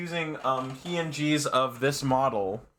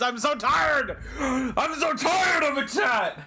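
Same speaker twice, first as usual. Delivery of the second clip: hammed-up tired yelling; exasperated shouting; 9 semitones higher than usual. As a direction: rant at chat in mock despair. Exasperated protest at chat allegations.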